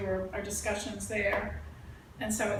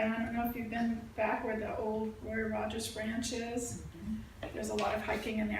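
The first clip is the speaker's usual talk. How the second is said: ordinary talk, nothing marked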